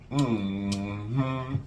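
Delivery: ordinary talk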